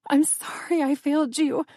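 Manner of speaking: an anguished, pleading apology. Acted. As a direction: let anguish crack through. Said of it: "Anguish rippled through my voice".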